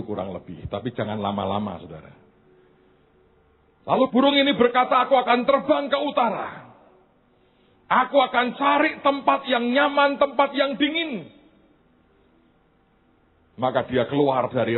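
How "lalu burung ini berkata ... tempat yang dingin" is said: high, loud preaching voice, driving on; full-force preaching. Acted. Preacher to congregation, full-force storytelling.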